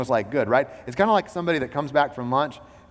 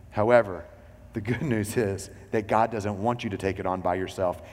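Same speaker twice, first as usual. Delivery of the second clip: ordinary talk, nothing marked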